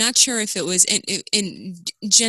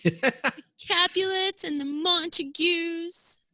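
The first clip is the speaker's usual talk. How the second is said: high, slow grand announcement; dramatic announcer; 9 semitones higher, slower than usual. Pretend. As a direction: proclaim it theatrically.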